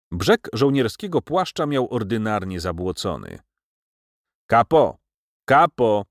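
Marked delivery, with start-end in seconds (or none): 4.5-6.0 two loud, falling calls; narrated shout, raised and emphatic. A narrator's reading. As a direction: call out sharply for the kapo. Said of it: "krzyknął do mnie".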